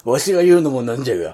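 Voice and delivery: lightly voiced old pirate; a hint of a gruff old man; creaky wise old man. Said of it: "エースの敗北者の話".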